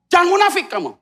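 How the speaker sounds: loud, high stern warning; firm rebuke. Retold or imitated. Jesus warning the teachers of the law, loud stern rebuke. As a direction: call out the hypocrites firmly. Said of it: "Kasih tahu sama ahli Taurat".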